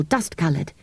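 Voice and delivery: ordinary delivery, nothing marked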